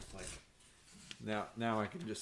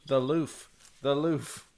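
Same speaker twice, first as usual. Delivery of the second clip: playful mock German chant of "the loof", slow and louder; 4 semitones higher, 7 dB louder, slower than usual. Friend jokingly chants "the loof is on fire" in mock German. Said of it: "Is that some German".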